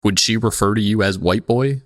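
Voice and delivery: usual speaking voice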